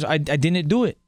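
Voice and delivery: imitated Wisconsin accent. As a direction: mimic the documentary guy in Wisconsin accent. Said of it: "this Canadian accent up there".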